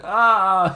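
loud, high imitated cry of pain; mock yell. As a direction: burst out with a comic pained yell. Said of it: "before going".

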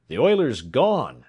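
loud, drawn-out alarm call; cried out. A narrator's reading. Voice at the train's head cries that the oiler escaped, crying out. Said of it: "cried a voice".

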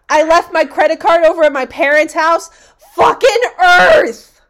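loud, high, fast complaint ending in a yell; mock rant shout. Fed up with forgotten credit card, loud ranting yell. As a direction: rant then blow up. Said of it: "it'll be like".

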